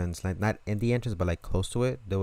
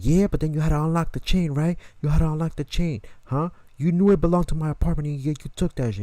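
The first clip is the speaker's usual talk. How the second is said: loud, higher, needling questions; angry interrogation; 7 semitones higher, 5 dB louder than usual. Retold or imitated. Owner grilling the thief about the chain, loud and higher. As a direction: corner him on the chain.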